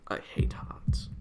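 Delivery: slow unvoiced whisper; whispered aside. Real, not acted. Muttering about his own heart condition, whispered. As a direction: breathe it out as a secret grumble.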